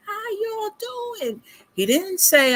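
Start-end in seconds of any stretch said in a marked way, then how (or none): 0.1-1.4 high, drawn-out, soft greeting; imitating Daddy's greeting. Daddy greeting fans who mistake him for a star, imitated high greeting. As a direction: sing it out high and slow, softly. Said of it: "you know that Charlie Johnson said".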